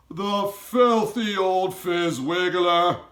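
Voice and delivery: high, loud, slow furious shout; giant shouting. A narrator's reading. Giant shouts in fury at the cruel matron, high and loud. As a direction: bellow the insult in outrage. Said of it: "shouted the B F G".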